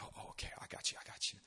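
soft, hushed reassurance; conspiratorial whisper. Retold or imitated. Campus leader secretly agreeing to help, whispered. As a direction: whisper it on the sly.

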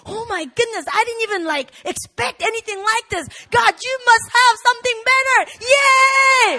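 high, loud joyful gush ending in a cheer; excited praise. Retold or imitated. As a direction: gush with delight at God's surprise. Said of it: "you're excited".

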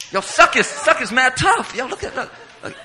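high, loud hyped-up exclaiming; impressed friends, imitated. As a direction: mimic schoolboys amazed by a tough kid. Friends marvelling at his toughness.

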